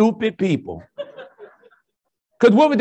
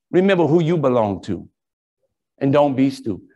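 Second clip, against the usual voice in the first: preaching; 7 semitones lower, 3 dB quieter than usual. Acted. Sermon closing exhortation.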